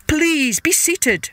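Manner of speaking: slow, lilting royal courtesy; gracious host king. A narrator's reading. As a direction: welcome an honored guest grandly. King welcomes the god.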